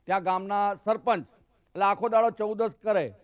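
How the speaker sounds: ordinary talk, nothing marked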